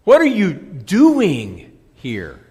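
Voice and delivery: slow it down and hit the word doing; slow, loud question leaning on DOING; stress on DOING. Shown as a demonstration. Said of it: "depending on where you put the emphasis".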